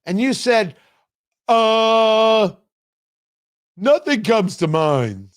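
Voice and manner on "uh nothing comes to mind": lightly voiced Harris, slow and halting; a mild mocking take on Kamala Harris, drawn out; a hint of Harris, slow and blank; Kamala Harris impression. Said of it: "That was my Kamala Harris impression".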